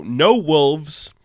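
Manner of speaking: usual speaking voice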